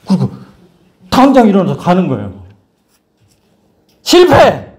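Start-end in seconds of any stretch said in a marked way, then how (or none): 4.0-4.7 high, loud burst; emphatic announcement. Real, not acted. Preacher announcing that the test failed, emphatically. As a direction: call out the verdict with force.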